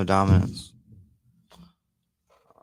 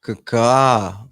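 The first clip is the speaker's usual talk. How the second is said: crow caw; 2 semitones higher, 6 dB louder than usual. Pretend.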